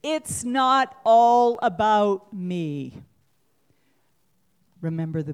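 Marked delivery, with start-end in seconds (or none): 0.0-3.0 it's not ALL about me, slow and loud; slow emphatic. Shown as a demonstration. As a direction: drag it out slowly, loud on ALL. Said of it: "Say it with me".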